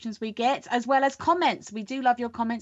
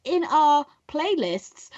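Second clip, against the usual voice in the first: bright, higher YouTube host pitch; professional presenter; 4 semitones higher than usual. Acted. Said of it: "I'm gonna be really professional".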